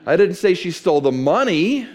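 loud, "money" swooping up at the end; stress on MONEY. Shown as a demonstration. Preacher showing how emphasis changes meaning, stress on money. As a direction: punch "money" with a questioning rise. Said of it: "if I change the emphasis".

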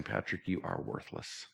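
tired, self-accusing voice at bedtime; bleak self-talk. Retold or imitated. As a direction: talk yourself down, alone at night. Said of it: "I'm gonna be sitting in the dark saying".

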